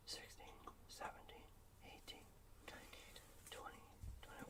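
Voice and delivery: low murmur fading into a whisper; hushed, spaced-out count, ending whispered; counting under breath. Real, not acted. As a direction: count softly to yourself, dwindling to near silence. Counting the delay to himself.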